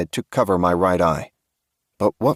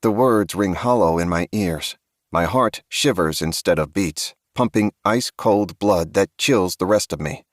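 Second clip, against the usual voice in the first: usual speaking voice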